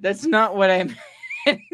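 words breaking into laughter; laughing. Real, not acted. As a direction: try to talk through laughing. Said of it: "I lost it".

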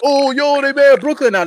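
sarcastic mock cheer; mock excitement. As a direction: ham up a bandwagon fan's joy. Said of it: "I'm supposed to be like".

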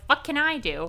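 high-pitched quoted retort; imitated helpless reply. Fan as Colin Robinson answering the council, high-pitched. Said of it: "He's like".

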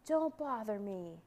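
slow, mildly roared rebuff; dragon's roar. A narrator's reading. Dragon rebuffs the boy, slow mild roar. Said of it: "roared the dragon".

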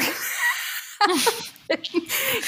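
high bursting laugh. Real, not acted. Laughs at herself.

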